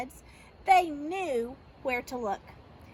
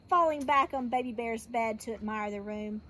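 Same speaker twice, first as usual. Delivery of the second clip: plain reading voice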